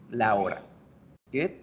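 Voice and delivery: ordinary talk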